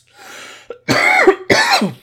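rough coughing after the yelling; real cough. Real, not acted. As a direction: cough hard, throat strained.

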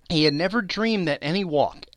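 usual speaking voice

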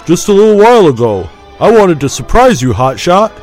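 high, loud, cocky friend; a lightly voiced self-assured pilot; confident, near smug. A narrator's reading. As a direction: tease him with easy confidence. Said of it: "confidence in the other's voice bordered smugness".

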